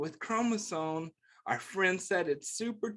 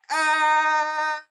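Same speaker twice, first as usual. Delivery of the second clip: high, loud playful yell; 8 semitones higher, 7 dB louder than usual. Pretend. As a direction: yell out big for the kids. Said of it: "I can yell really loud".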